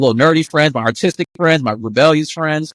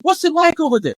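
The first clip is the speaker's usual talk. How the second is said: high, thrilled question; eager curiosity; 12 semitones higher than usual. Retold or imitated. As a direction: ask like meeting a celebrity. Excited question to student.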